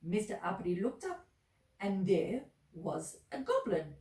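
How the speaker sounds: usual speaking voice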